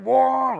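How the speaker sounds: one high, loud imitated engine boom. A driver mimicking the rival's engine in his window, loud boom. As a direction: punch it like an engine note.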